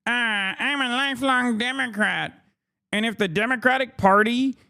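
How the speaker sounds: mock the man's complaint in a high put-on voice; mocking Fred Gutenberg. Mocking the quoted parent's reply.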